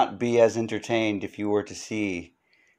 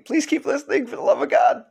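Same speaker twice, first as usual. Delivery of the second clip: mock pleading; faster than usual. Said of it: "begging listeners to keep listening".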